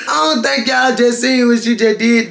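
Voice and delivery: ordinary delivery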